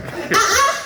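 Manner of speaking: high, slow, exasperated scolding teacher voice; scolding Nigerian teacher. Retold or imitated. Nigerian teacher scolding a learner, high exasperated scold. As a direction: berate a hopeless pupil from way up high.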